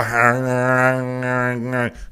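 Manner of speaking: long, mock chomping growl; noisy pretend pigging out; imitated gorging noise. Host showing couch pig-out, imitated gorging noise. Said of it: "just go".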